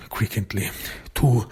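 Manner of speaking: ordinary talk, nothing marked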